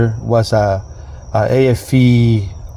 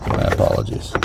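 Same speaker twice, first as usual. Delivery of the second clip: whispered; 5 dB quieter than usual. Real, not acted. Flustered vlogger apologizes mid-stumble.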